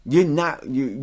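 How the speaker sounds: ordinary talk, nothing marked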